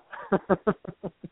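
soft high friendly chuckle; kind gentle laugh. Real, not acted. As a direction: laugh warmly along. Host laughs warmly at banter.

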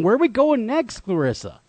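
normal talking voice

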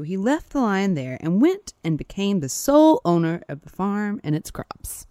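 lively folktale telling with big pitch swings; animated storyteller; story narration. A narrator's reading. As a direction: tell it like a fable, stressing the key words. Reads a folktale aloud.

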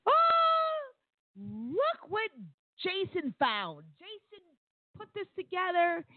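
high, gushing mock praise; fawning coworker voice. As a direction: squeal it like a delighted coworker. Coworkers praising Jason's same idea.

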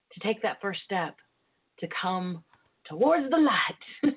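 ordinary talk, nothing marked

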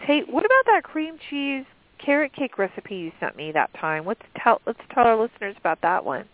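ordinary talk

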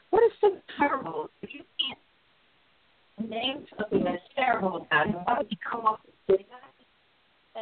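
retelling his unspoken reply to a gym stranger, indignant; indignant retort. Retold or imitated. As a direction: fire back the question at her, incredulous. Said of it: "I was thinking".

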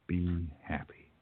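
soft, slow whisper; whispered wish. Acted. Joe whispers his wish for Lana's happiness. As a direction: breathe it out gently.

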